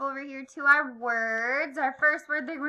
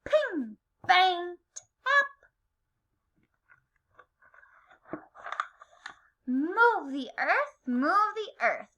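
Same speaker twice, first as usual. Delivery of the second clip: lively picture-book read-aloud with acted sound words; reading to children; slower than usual. A narrator's reading. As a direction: read rhythmically, stretching key words and playing up the noises.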